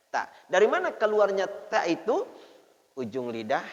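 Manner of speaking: usual speaking voice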